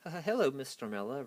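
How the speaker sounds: ordinary delivery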